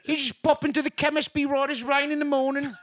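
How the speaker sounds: high, chirpy mock English accent; high mock English accent, breezy. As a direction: cheerfully dismiss it like a Brit. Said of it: "Things are different in England".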